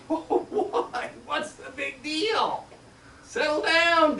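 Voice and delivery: high, breathy laughing brush-off; laughing tease. Retold or imitated. Gina laughing at Debbie's fuss, teasing. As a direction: laugh off her worry.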